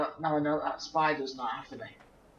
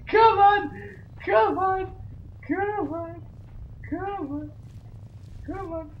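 hysterical goading; much slower than usual. Real, not acted. Player egging on the game creature, high hysterical squeal fading out.

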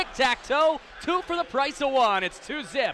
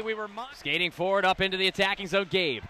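usual speaking voice

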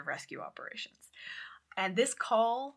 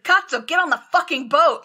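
loud, higher furious swearing; imitated shout; 8 semitones higher, 13 dB louder than usual. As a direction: lose it completely on him. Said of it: "there's often yelling".

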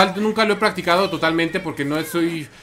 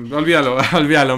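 laughing; 3 dB louder than usual. Real, not acted. Laughs at a chat joke.

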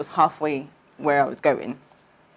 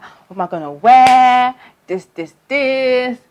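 excited panic; 6 semitones higher, 9 dB louder, slower than usual. Retold or imitated. Excited about wedding invite.

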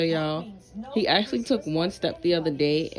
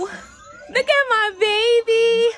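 high, slow, louder gushing; 6 dB louder, much slower than usual. Real, not acted. Mom shows off her baby.

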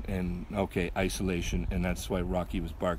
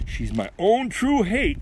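Backward Bill; 12 semitones higher, 5 dB louder, slower than usual. Acted. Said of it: "says Backward Bill".

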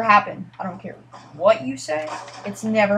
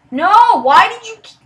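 high, loud protest; dismayed cry; 9 semitones higher, 8 dB louder than usual. Real, not acted. Gamer protests a mishap in the match, dismayed cry.

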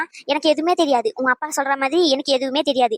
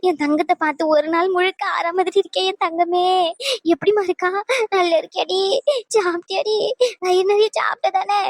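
higher, weepy endearments; put-on tearful fondness; 4 semitones higher than usual. Old mother to runaway daughter on phone, hammed-up tearful. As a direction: lay on the motherly tears. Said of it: "கிழவி என்ன நடிப்பு நடிக்கா".

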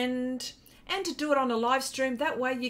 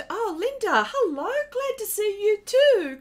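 excited greeting; 10 semitones higher, 8 dB louder than usual. Real, not acted. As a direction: call the greeting out brightly to the chat. Greeting a viewer in chat.

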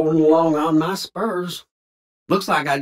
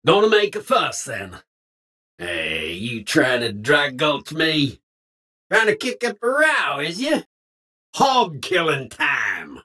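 put-on folksy Old West cowpoke voice, drawled twang; old cowboy. Game cowboy barking lines at strangers and outlaws, folksy twang. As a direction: play a grizzled frontier cowboy talking.

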